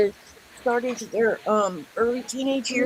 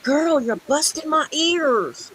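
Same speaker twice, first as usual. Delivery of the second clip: exasperated mom; 6 semitones higher, 3 dB louder, much slower than usual. Retold or imitated. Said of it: "and I'm like".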